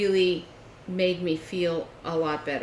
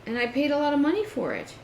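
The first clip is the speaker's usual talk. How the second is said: higher, louder, exasperated scolding; angry complaint; 6 semitones higher, 7 dB louder than usual. Retold or imitated. Retelling her complaint to him, higher louder angry scolding. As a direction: lay into him, you had one job.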